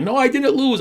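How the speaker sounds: high-pitched mock kid's protest, mildly upset; retold tearful protest. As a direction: imitate a child refusing to accept losing, pitched well up. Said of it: "break down into tears and stop bawling his eyes out".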